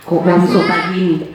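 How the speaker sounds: usual speaking voice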